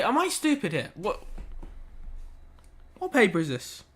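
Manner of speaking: ordinary delivery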